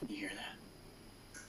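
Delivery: slow hushed whisper. Real, not acted. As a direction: whisper so nothing hears you. Hushing during ghost hunt.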